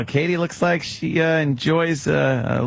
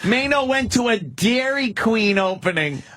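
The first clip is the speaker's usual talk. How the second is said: higher, scornful incredulity; mocking disbelief; 10 semitones higher than usual. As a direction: sneer at the lame gig. Mocking the jocks' gig.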